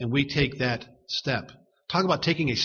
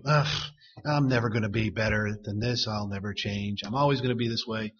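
low, soft grumble of resignation; mock self-pitying defeat, pitched down; defeatist; 5 semitones lower, 4 dB quieter than usual. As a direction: sigh "ugh" and give up under your breath. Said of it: "Faith does not speak that way".

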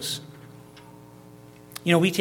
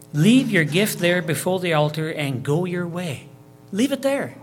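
loud, emphatic reading of scripture; scripture reading, louder; 5 dB louder than usual. Acted. Preacher pressing the command loudly on the congregation. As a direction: press each word of the command home. Reads scripture aloud in sermon.

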